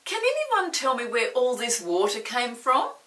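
loud, puzzled bather; booming question. Acted. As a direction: call it out like an annoyed bath owner. Character demands answer.

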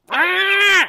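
loud, high-pitched imitated pig squeal. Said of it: "And the pig goes".

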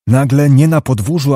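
plain reading voice

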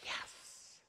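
hushed, voiceless cheer; mock celebration under the breath; imitated student relief. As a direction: whisper a drawn-out triumphant yes. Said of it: "we would all go".

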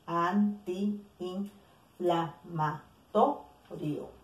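antiinflamatorio drawn out very slowly, syllable by syllable; slow example word. Shown as a demonstration. Teacher to students showing hiatus, very slow. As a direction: stretch out antiinflamatorio so students hear every vowel.

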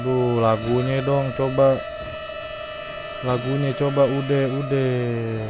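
loud frightened pleading. Real, not acted. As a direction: beg the music to stop, rattled. Pleading with the scary game music.

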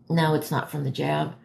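ordinary delivery

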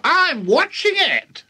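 high, slow granny screech; drawn-out high granny shout; shouting old woman. A narrator's reading. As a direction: holler back as the old girl. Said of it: "the old girl shouted back".

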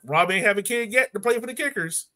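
ordinary delivery

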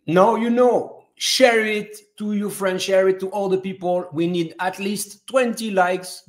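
high-pitched urging, pushing each phrase; insistent appeal. Real, not acted. Host urging viewers to share, high insistent appeal. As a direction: press the audience to share it.

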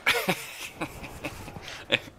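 airy, high-pitched giggle; real laughter. Real, not acted. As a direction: crack up at a funny memory. Amused by remembered crash.

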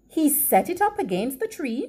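plain reading voice

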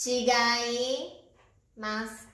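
measured dictation for learners writing it down. Shown as a demonstration. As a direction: read it out piece by piece with pauses. Dictation, word read out in pieces.

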